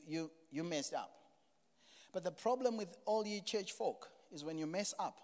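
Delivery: ordinary talk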